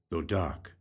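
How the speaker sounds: ordinary delivery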